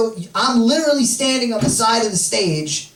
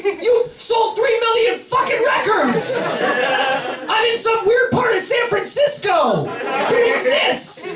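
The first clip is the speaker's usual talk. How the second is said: wished-for outburst; 10 semitones higher than usual. Pretend. Comic to the Imagine Dragons singer, exasperated shout. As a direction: shout at him in mock outrage. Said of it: "I wanna say".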